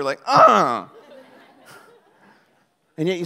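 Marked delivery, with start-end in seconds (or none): 0.3-0.9 put-on loud, petulant toddler huff; mock toddler's stroppy "uh"; short burst of childish protest; toddler tantrum grunt. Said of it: "like a little toddler".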